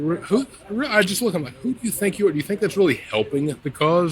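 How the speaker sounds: high, incredulous put-down; mocking disbelief. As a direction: scoff at them in disbelief. Mocking posers in corpse paint.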